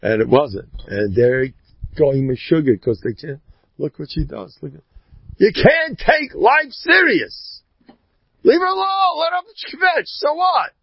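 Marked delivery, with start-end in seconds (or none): 5.4-10.7 very high-pitched dismissive cry; exasperated exhortation. Acted. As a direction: urge him to shrug it off, high and exasperated. Animated exhortation to shrug off kvetching.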